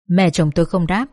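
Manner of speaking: plain reading voice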